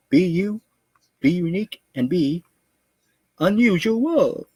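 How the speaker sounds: high, stretched sing-song sign-off. Acted. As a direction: lift it up high and drawn out.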